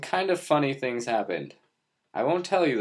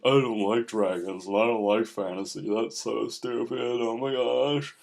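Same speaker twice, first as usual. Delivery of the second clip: mock whiny guy. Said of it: "some guy out there who's like".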